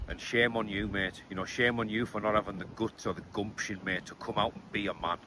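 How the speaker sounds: hushed, menacing put-down; quiet contempt. Real, not acted. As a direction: drop the voice and sneer. Taunts rival into camera, hushed.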